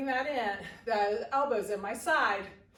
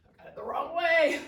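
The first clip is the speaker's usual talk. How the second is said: laughing; 6 semitones higher than usual. Real, not acted. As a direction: laugh it out, amused at the slip. Laughs at own mistake.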